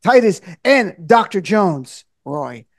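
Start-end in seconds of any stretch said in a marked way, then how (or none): 2.2-2.7 disappointed. Real, not acted. Host introduces Doctor Jones, disappointed saying "Roy". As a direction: let the name Roy drop, deflated and softer. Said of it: "you say the Roy with such disappointment".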